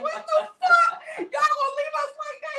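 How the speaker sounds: very high, laughing mock wail; hammed-up cry of disbelief at being abandoned; squealed protest breaking into laughter; retold outraged wail.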